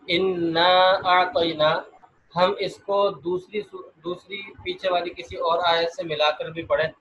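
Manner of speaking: ordinary talk, nothing marked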